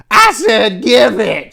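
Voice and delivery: high-pitched, slow, loud scream; screamed demand. A narrator's reading. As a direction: bellow it slowly at the victim. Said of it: "Chatty screamed".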